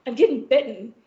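ordinary talk, nothing marked

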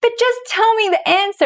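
high whiny plea; pleading. Retold or imitated. Begging her guides for an answer, high and whiny. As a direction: beg for the answer in a high whine.

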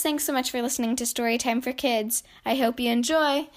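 plain reading voice

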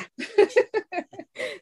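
high embarrassed giggle; high self-conscious laugh; laughing at herself. Real, not acted. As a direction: laugh off the bad voice. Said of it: "sorry about my Raph voice".

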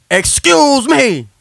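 high and drawn-out, each syllable stretched; slow pointed excuse me. Retold or imitated. Big man on the train pushing past to his stop, slow and high. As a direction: lean on it slowly, irritated.

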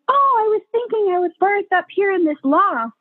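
high-pitched imitation of an eager client; dreamy client. Client showing midwives her loft, imitated high and gushing. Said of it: "some people are like".

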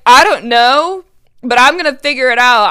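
excited. Retold or imitated. Recalling her excited reply to listener.

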